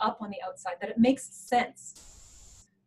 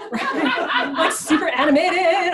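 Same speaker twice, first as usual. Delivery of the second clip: laughing; 7 semitones higher, 8 dB louder than usual. Real, not acted. Describes herself as super animated.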